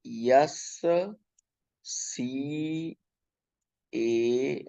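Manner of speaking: slow, separated letters; spelling out. Shown as a demonstration. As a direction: spell it out letter by letter with long gaps. Spelling out scanner for students. (एक-एक अक्षर रुककर बोलते हुए)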